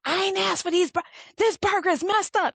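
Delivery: high-pitched indignant complaint; worked-up imitation of a complaining customer; irate customer. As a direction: retell an angry customer's outburst. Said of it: "the lady was screaming and yelling".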